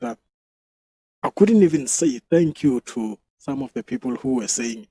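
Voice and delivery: ordinary talk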